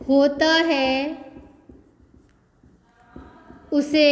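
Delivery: definition spoken word by word with long gaps; slow dictation. Shown as a demonstration. Teacher dictating a definition, very slow. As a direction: dictate for the class to copy down. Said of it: "teacher dictates definition slowly".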